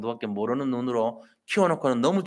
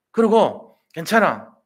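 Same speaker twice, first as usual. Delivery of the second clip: reassuring; 5 semitones higher, 7 dB louder, slower than usual. Retold or imitated. Reassuring a struggling child.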